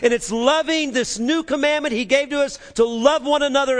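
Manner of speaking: high, loud preaching build; rising pulpit preaching; fervent preaching. Acted. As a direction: preach it out with fire. Preaching at full force.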